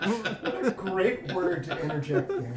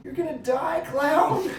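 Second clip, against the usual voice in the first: high, louder movie-quote yell; mock yell; 10 semitones higher, 5 dB louder, slower than usual. Quoting a favorite movie line with a mock threat. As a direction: shout it like you mean to win. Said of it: "My favorite quote".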